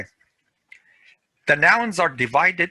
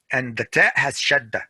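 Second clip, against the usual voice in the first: normal talking voice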